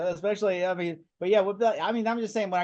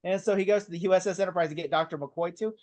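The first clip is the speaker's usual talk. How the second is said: ordinary talk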